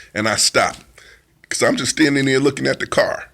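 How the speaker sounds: normal talking voice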